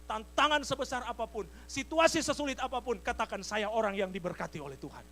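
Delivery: high, loud declaration of faith; preaching at full force. Real, not acted. Preacher urges congregation to receive help by faith, forceful preaching.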